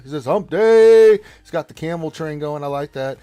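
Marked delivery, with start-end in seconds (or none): 0.2-1.2 loud, high yell of the message; shouted quote. Acted. Reading a viewer's chat message, loud high yell. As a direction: belt it out like a chant.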